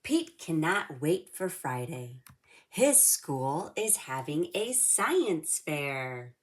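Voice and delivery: lively read-aloud storybook voice; reading to children. A narrator's reading. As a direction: read it like storytime for little kids. Reads picture book to kids.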